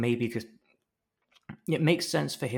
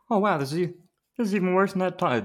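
higher, louder put-on character voice; hammed-up, raised-voice impression attempt; attempted Steve; 9 semitones higher, 7 dB louder, faster than usual. Captive Steve reacting to his torture, overdone voice. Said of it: "I'll do the Steve voice".

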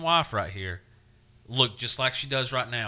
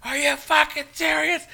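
outraged yell, much higher and louder; slowing into a furious, high-pitched cry at the end; irate; 10 dB louder than usual. Retold or imitated. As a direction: rant at the TV in disbelief. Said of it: "fucking irate at this point".

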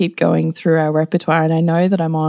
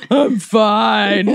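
grumpy reply; 4 semitones higher than usual. Retold or imitated. Son answers from the mattress.

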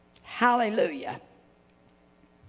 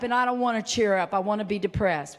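mock sulky voice; 7 semitones lower, faster than usual. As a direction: play the grumpy churchgoer. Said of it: "So you're about to cheer me up".

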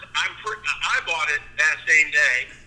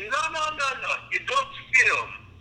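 high-pitched French-ish hybrid protest; hybrid foreign accent; 10 semitones higher than usual. Retold or imitated. Customer correcting the video clerk, high hybrid foreign accent. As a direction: imitate a customer objecting in mixed accent. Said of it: "like, three accents I'm doing here".

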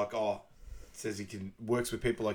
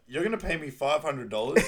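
higher, louder incredulous question; incredulous retort; 4 semitones higher, 6 dB louder than usual. Retold or imitated. Retelling his reply to her price demand, mock incredulous. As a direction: throw the price back in mock disbelief. Acted haggling in film retelling.

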